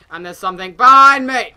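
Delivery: loud rise into a panicked cry; sudden alarm. Real, not acted. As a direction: cry out as if something is right there. Alarm, then takes it back.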